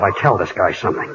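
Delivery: ordinary talk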